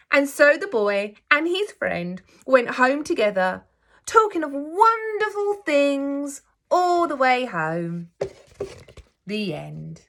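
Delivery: gentle, expressive storybook reading; teacher reading a picture book aloud to her class; reading to children. A narrator's reading. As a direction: read it like story time for little ones.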